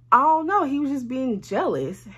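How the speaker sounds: retold fed-up answer; exasperated. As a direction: blurt it out like it's obvious. Answering why Chris got mad.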